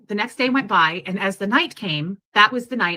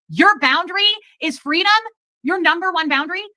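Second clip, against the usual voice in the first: high-pitched, incredulous throwback questions; indignant retort; 9 semitones higher, 3 dB louder than usual. Retold or imitated. Woman retelling standing up to him, indignant retort. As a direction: throw it back at him, affronted. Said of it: "stuck up for myself".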